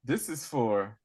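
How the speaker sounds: low, soft, matter-of-fact put-down; condescending. Retold or imitated. Translator correcting the madam's mistake, condescending and matter-of-fact. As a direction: correct them coolly, quiet and measured. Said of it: "condescending with her insults".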